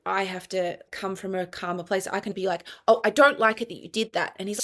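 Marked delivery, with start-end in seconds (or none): 2.9-4.3 sharply raised, louder telling-off. Retold or imitated. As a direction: tell him off a bit too loudly. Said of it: "Stop yelling at me".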